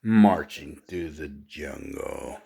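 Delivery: normal talking voice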